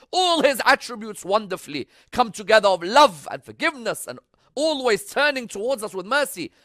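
high, loud sermon delivery; fervent preacher in full flow; impassioned preaching. Real, not acted. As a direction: raise the voice and proclaim it. Preaches with raised voice.

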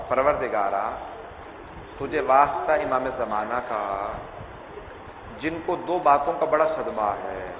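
a hushed, grieving plea to God; soft prayer. Acted. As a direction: pray quietly, heavy with grief. Closing supplication.

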